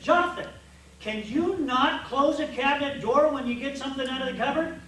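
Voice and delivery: mock-exasperated scolding; scolding parent. Father scolding son Jonathan over cabinet door, loud nagging. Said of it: "Did you just hear yourself?".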